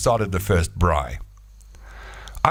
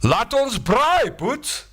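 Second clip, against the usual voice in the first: high, slow Afrikaans call to braai; much higher hearty Afrikaans voice; Afrikaans caveman; much slower than usual. Acted. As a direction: invite your mate to braai in Afrikaans. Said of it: "that caveman said".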